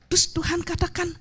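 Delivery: usual speaking voice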